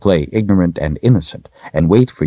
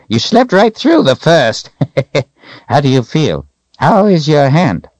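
put-on high-pitched, loud old wizard with a chuckle; tease, laugh, then ask in a high old voice; chuckling old codger, pitched way up; teasing old man; 11 semitones higher, 5 dB louder than usual. A narrator's reading. Old wizard Zed's character voice.

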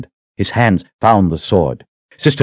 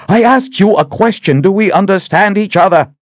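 much higher, louder demanding yell; furious shout; 13 semitones higher, 4 dB louder than usual. Acted. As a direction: scream the question at him. Sister demands an answer again.

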